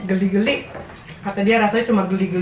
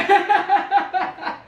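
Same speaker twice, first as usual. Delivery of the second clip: high bright burst of laughter; laughing; 8 semitones higher than usual. Real, not acted. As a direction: let out a real laugh. Laughs after tasting pete.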